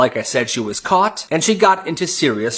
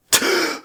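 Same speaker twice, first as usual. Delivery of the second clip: sharp high gasp. Acted. Crowd reacts to announcement.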